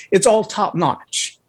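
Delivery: usual speaking voice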